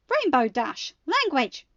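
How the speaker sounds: warning. A narrator's reading. Rarity scolding Rainbow Dash for cursing, loud warning. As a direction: scold her for the swear word. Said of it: "Rarity warned".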